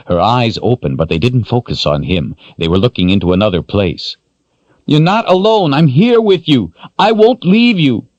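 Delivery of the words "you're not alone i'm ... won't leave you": urgent reassuring man. Acted. Richard voiced, urgent.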